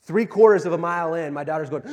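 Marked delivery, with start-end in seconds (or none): none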